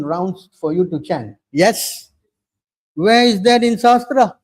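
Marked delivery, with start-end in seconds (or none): 2.9-4.4 loud challenge. Real, not acted. Challenging opponents in a heated sermon.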